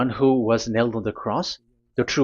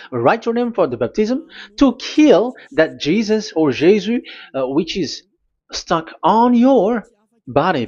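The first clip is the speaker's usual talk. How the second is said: raised, emphatic preacher's voice, higher and louder; fiery preaching; 4 semitones higher, 3 dB louder than usual. Acted. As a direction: preach it hard to the faithful. Preaching at full force.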